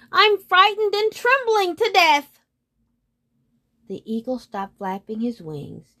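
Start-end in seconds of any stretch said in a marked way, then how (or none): 0.1-2.4 very high, loud storybook wail; frightened cry. A narrator's reading. Moon to the roaring eagle, crying out frightened. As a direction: wail it out in mock terror. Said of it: "cried the moon".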